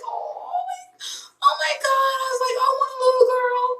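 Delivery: very high, soft melting squeal; reenacted high-pitched gush; gushing coo. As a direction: coo in a tiny high voice over someone adorable. Gushing over the sweet little girl.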